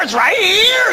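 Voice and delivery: high, loud triumphant voice; mock wife producing the salt. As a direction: show him it was there all along. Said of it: "she pulls it out of thin air".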